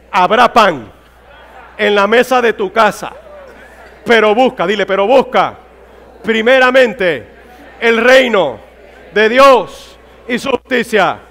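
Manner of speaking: loud phrases, spaced out by pauses; declamatory preaching. Real, not acted. Preacher feeding congregation lines to tell their neighbour, declamatory. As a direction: proclaim it piece by piece for the crowd to echo.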